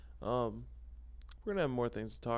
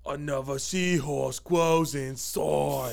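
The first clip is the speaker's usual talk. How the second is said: slow clip quote; 5 dB louder, much slower than usual. Retold or imitated. Quoting a clip, teaser.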